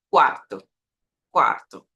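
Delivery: quarto with a light, brief R tap. Shown as a demonstration. Teacher showing how fluency sounds, natural R. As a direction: say quarto fluently, R not exaggerated. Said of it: "Vê como é mais natural?".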